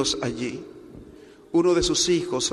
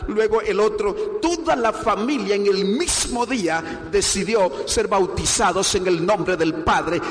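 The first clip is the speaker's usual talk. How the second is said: preaching at full force; 5 semitones higher than usual. Acted. Pastor proclaims the family's baptism to the congregation, building fervent preaching. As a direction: lift the whole church toward the climax.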